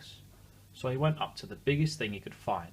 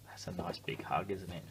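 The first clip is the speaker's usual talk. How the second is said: whisper; 4 semitones lower, 8 dB quieter than usual. Real, not acted. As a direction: whisper it warmly at bedtime.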